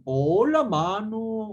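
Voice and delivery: imitating a forceful northern Peru accent; a bold northern Peruvian "Hola, mano"; strong northern Peruvian accent. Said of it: "o hablan fuerte".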